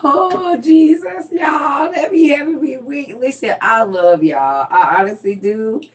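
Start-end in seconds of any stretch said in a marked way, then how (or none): 0.0-3.2 excited. Real, not acted. Still laughing at comment.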